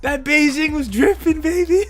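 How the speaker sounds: high, loud, slow put-on voice; retelling his text to a friend, high and hammed-up; mock exclamation. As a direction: drag out the joke to a friend. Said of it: "I was like".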